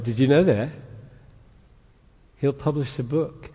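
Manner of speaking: normal talking voice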